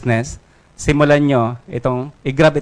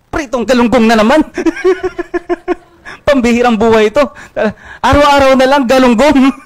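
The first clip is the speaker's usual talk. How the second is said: higher, louder exasperated cry broken by laughter; laughing lament; 10 semitones higher, 4 dB louder than usual. Acted. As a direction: laugh through the complaint about the same lunch. Juan laments same fish again. (natatawang reklamo, mas mataas at malakas)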